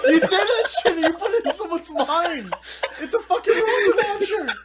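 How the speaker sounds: high, loud and laughing; comic incredulous outburst; exasperated rant. Retold or imitated. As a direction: protest it with amused outrage. Retold exasperated reply to coworker.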